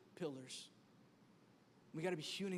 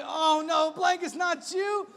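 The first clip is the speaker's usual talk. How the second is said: high, loud comic wail; mock dismay; 9 semitones higher, 12 dB louder, much slower than usual. Sleeper wrapped tight by his blanket, mock dismay. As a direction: cry out as if betrayed, hammed-up.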